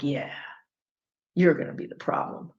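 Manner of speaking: low, hushed, half-whispered threat; a cold boss marking you as trouble; menacing boss. Retold or imitated. A boss zeroing in on an employee, low hushed menace. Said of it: "they're like".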